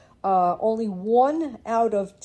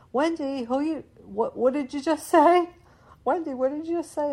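high, raised-voice questioning call; imitated yell; 8 semitones higher, 5 dB louder than usual. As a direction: mimic someone yelling a puzzled question across the house. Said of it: "Robert will yell".

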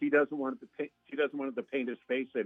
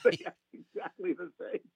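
laughing; 7 semitones higher than usual. Real, not acted. Laughing while comparing characters.

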